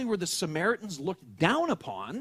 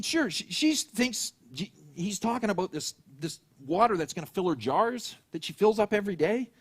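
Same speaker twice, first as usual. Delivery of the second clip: ordinary talk, nothing marked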